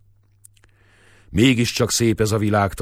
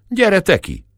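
high, loud challenge; angry shout; 12 semitones higher, 5 dB louder than usual. Acted. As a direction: yell at him to come out. Shepherds quarrel through the window.

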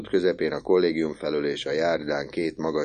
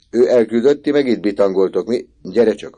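scolding uncle; 5 semitones higher, 7 dB louder than usual. Acted. Uncle scolds Misi for idling.

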